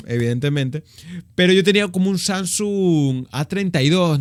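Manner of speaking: normal talking voice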